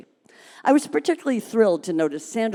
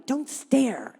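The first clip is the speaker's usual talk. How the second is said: hissed; 6 semitones higher, 3 dB quieter than usual. Acted. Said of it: "Angela hissed".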